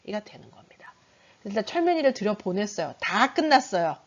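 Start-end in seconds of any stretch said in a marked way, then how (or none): 0.0-0.9 whispered aside. Real, not acted. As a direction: drop to a whisper. Trailing aside. (혼잣말처럼 속삭임)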